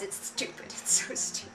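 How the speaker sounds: ordinary talk, nothing marked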